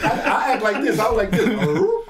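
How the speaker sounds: high, loud, laughing through the words. Real, not acted. Instructor retells a customer's remark to the hosts, laughing. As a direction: crack up while telling the story. Cracking up over retold remark.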